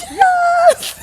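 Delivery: imitating her thrilled scream of yes; ecstatic yes. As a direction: squeal yes with delight, then laugh. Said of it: "And she was like".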